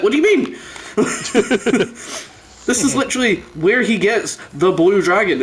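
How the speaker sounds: ordinary talk, nothing marked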